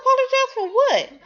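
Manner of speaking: very high, laughing, hammed-up confusion; high mock voice. Tired lead answering the attorney, hammed-up high squeak.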